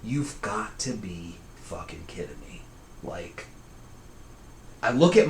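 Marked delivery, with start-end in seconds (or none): 0.0-3.5 under the breath, low and soft; quiet disbelief, thought to oneself; muttered inner thought. Retold or imitated. Woken sleeper thinking to himself about the cousin's calling, muttered. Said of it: "thinking to myself".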